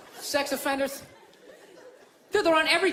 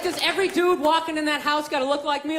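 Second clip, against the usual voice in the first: ordinary talk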